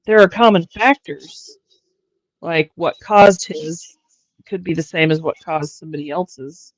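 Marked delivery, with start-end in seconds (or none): 0.1-1.6 hiccuping while talking; hiccups. Real, not acted. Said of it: "I have the hiccups".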